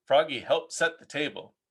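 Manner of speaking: ordinary delivery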